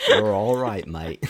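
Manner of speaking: imitated Australian accent, punched opening; Australian game voice. American imitating an Australian game voice pack, put-on Australian accent. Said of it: "'cause of his voice back".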